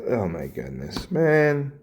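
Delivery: usual speaking voice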